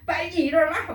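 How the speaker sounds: high-pitched, urgent mock command; acted parental order. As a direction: order a child up, imitating a parent. Parent ordering child to get up.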